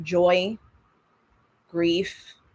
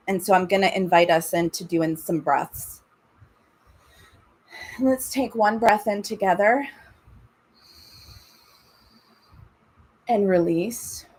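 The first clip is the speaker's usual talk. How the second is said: calm, unhurried breathing guidance; guided meditation. Acted. Leads breathing and body scan.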